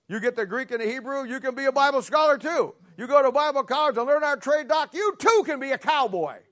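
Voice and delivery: high, loud sales-pitch parody; jeering pulpit voice; mocking preaching. Real, not acted. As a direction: proclaim it high and loud, ridiculing the idea. Mocking preacher echoes the cowboy song.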